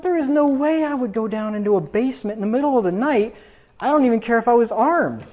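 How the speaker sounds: high-pitched, insistent refusal; incredulous, animated retelling; emphatic disbelief. Retold or imitated. Retold emphatic refusal, in awe of grandma.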